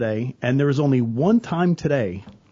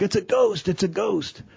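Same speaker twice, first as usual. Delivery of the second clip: higher, panicky cry of "ghost"; mock panic; 6 semitones higher than usual. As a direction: play a spooked disciple, half in jest. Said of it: "the disciples are freaking out".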